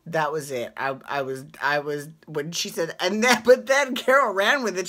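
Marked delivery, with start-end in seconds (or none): 3.0-4.9 loud, high, laughing through words; can't stop laughing; real laughter bursting out. Real, not acted. Laughing while retelling.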